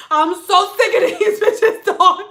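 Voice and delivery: high, loud comic venting; exasperated outburst. Retold or imitated. Stylist venting to husband outside, exasperated outburst. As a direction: blow up with a laugh in it. Vent to husband, boiling over.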